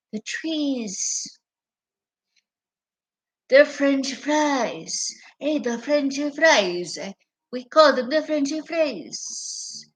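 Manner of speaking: high, drawn-out mocking imitation; lightly hammed-up politician imitation; mock speech voice. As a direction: parody her slow, sing-song speech.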